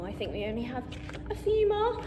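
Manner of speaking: ordinary delivery, nothing marked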